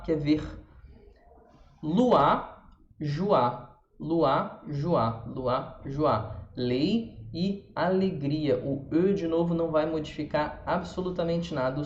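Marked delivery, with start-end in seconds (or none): 1.8-6.3 loi and joie with OI said as ua; loi, joie, each OI said as "ua"; French loi and joie. Shown as a demonstration. Teacher drilling loi and joie for her students. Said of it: "O I vai ter som de ua".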